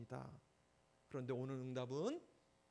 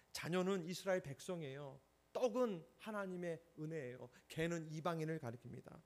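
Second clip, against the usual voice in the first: ordinary delivery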